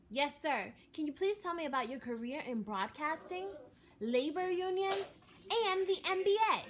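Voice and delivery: put-on a boy too excited to sit still; eager boy asking. A narrator's reading. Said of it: "asked L J".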